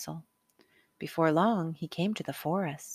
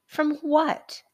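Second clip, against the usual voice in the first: higher, doubtful mother's question; mother asking; 9 semitones higher, 4 dB louder than usual. A narrator's reading. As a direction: ask it like a skeptical mother. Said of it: "asked his mother".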